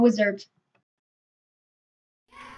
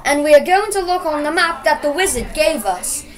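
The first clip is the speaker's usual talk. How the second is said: plain reading voice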